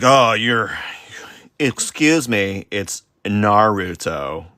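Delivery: slow, overemphasized mock correction; passive-aggressive correction. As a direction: imitate a pointedly polite corrector, drawing out the words. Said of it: "overly overemphasizing the passive aggression".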